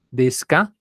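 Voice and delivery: "Desu ka?" con subida en "ka"; entonación de pregunta. Shown as a demonstration. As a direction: sube la voz en "ka". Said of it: "Esa es la entonación de pregunta". (modelo de pregunta con "ka" ascendente)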